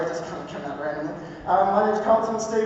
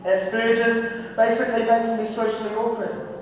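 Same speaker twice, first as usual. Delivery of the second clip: higher-pitched, measured poetry recital with weighted pauses; spoken word poetry; 6 semitones higher than usual. Acted. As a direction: deliver it as a rhythmic spoken word testimony. Performs spoken word poem.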